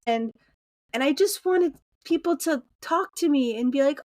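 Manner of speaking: ordinary delivery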